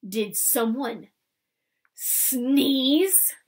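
slow sneer building loud. A narrator's reading. Laban threatening the muttering crowd, sneering. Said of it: "he sneered".

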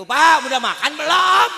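teriakan keras menirukan istri ke suami yang budek; teriak memanggil suami. Retold or imitated. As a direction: teriak lantang biar suami dengar. Memanggil suami yang kurang dengar. (istri berteriak ke suami yang kurang dengar, keras sekali)

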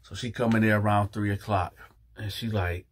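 usual speaking voice

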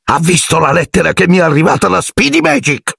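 high, insistent demand; a high insistent caretaker; accusing caretaker. Acted. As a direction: push the proof at him. Filch accusing.

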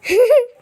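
loud high laugh; a sudden laugh out loud; burst of laughter. Real, not acted. Laughing at death.

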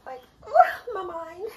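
holding back tears, breaking on the words; on the verge of tears. Real, not acted. Overwhelmed by her to-do list, she starts to cry. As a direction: voice cracking into tears. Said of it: "I'm about to cry".

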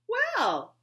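high, loud cheerful call. Acted. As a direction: call out brightly to the child. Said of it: "her mother called out cheerfully".

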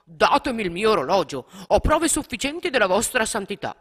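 the gentleman shouting at Don Bosco for his watch. A narrator's reading. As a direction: yell for the stolen watch. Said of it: "prese a gridare".

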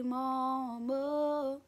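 drawn-out imitation of the singer's accent; [name]. Fan showing how the singer says it, drawn-out accent imitation. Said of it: "I like the way he say".

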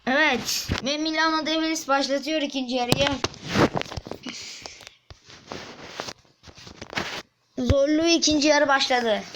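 lightly done match announcer delivery. Acted. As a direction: commentate the kickoff like a sports announcer.

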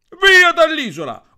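very loud high yell, slowed down; yelling Piero. Acted. Piero shouts at the others to leave the island, loud high yell. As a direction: throw him off the island with a bellow. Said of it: "urlò Piero".

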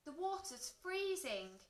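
high-pitched shocked exclamation; gasped alarm. A narrator's reading. Caretaker finding the pool cold, shocked. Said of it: "gasped the school caretaker".

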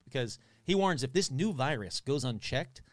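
ordinary delivery

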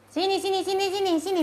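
high, rapid repeated beckoning; pet calling. Real, not acted. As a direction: call your pet over eagerly. (panggilan cepat bernada tinggi)

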